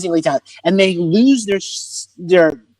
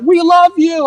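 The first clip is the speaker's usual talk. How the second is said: mock yell; 10 semitones higher, slower than usual. Someone yells to the comic on stage, high mock yell. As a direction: imitate an adoring shout from the crowd. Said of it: "someone yells out".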